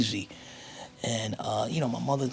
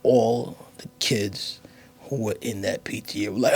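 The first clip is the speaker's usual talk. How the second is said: ordinary talk, nothing marked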